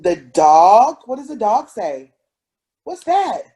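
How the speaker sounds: usual speaking voice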